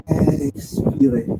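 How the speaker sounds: slow, guiding exhale cue; breathing cue. Real, not acted. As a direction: stretch the word through the exhale. Cueing a long exhalation.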